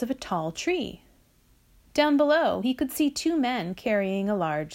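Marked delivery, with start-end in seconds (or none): none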